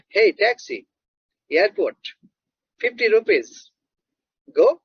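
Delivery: bare words with a pause after each; simplified taxi request. Shown as a demonstration. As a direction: hail a driver in separate, word-by-word chunks. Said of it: "But if you ask the taxi driver that".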